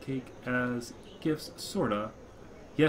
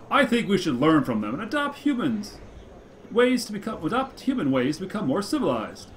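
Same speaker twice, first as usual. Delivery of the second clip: pompous orator; 6 semitones higher, 4 dB louder than usual. Acted. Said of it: "puffed up with multisyllable importance".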